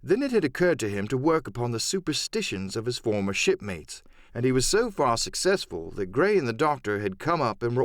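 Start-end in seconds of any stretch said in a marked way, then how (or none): none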